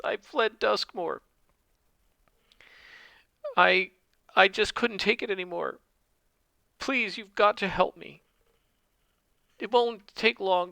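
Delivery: high, tearful pleading woman's voice; sobbing cult woman. Acted. Fake cultist woman begging Wiglaf to cut off her brand, high tearful plea. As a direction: beg through fake tears, pitched high. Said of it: "she's sobbing and crying".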